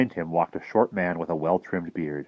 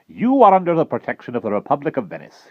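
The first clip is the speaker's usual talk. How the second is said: higher, louder commanding voice; grand admiral; 8 semitones higher, 6 dB louder than usual. Acted. Admiral declaring his aid to the travelers, grand and commanding. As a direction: proclaim it like a naval commander.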